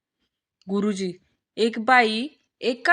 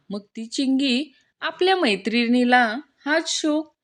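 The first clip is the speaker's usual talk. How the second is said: usual speaking voice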